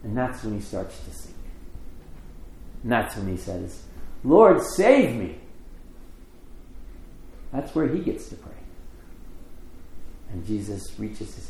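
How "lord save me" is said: slow, loud, high plea; acted-out desperate call; cry for help. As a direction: call out for rescue, drawing it out. Peter sinking, cries for help.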